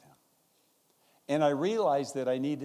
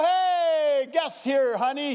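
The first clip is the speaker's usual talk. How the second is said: much higher, louder yell to his wife; panicked shout; 14 semitones higher, 8 dB louder, much slower than usual. Retold or imitated. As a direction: shout for the wife, half laughing, half scared.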